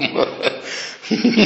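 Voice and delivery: high breathy chuckle; amused laugh. Real, not acted. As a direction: break into a laugh at your own skit. يضحك على الحوار الذي مثّله. (ضحكة ساخرة)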